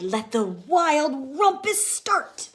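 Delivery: slow, loud, high proclamation; excited cry. Acted. Max calling the monsters to dance, loud. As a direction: start the party. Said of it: "Max cried".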